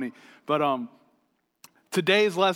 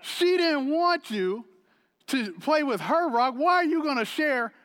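indignant dad; 10 semitones higher than usual. Retold or imitated. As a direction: protest like a fussing parent. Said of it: "pastor, chime in".

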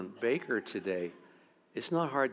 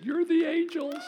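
awed realization; slower than usual. Retold or imitated. Boxer's tearful realization.

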